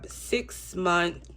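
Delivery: ordinary delivery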